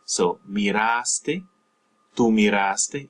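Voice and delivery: miraste stressed on RAS, mee-RAHS-tay. Shown as a demonstration. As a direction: lean into the a of miraste. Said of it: "you're just stressing that A".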